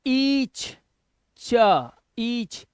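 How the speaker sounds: "ईच" drawn out, then "च" on its own; stretched, careful phonics demo of "ईच"; CH sound demo. Shown as a demonstration. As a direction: sound out "ईच" slowly, landing on "च". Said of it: "चा से देखो".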